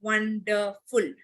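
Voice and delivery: "Wonderful" slowly as "won-der-ful", with gaps; syllable by syllable. Shown as a demonstration. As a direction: break "Wonderful" into three separate beats. Teacher demonstrating syllable division.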